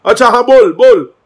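high, loud challenging yell; mock angry shouting. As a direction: imitate him shouting, daring someone. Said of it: "तू क्यों चिल्ला रहा है यार". (नकली गुस्से में ललकारते हुए चिल्लाना)

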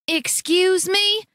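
you cannot believe he said no; indignant. A narrator's reading. Wife to defiant husband, indignant.

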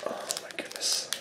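breathless whispered oh my goodness; awed whisper. Real, not acted. Hopeful opener whispering before the reveal. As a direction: hush it out in awe. Hoping for Lugia pull.

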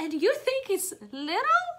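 can't believe he calls it little; astonished Piggy. Acted. Reading to kids, Piggy shocked the whale calls it little.

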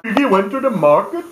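nursery rhyme in a badly faked Indian accent. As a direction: put on a clumsy Indian accent, lilting up at the end. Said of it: "That was a very bad Indian accent".

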